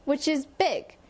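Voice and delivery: usual speaking voice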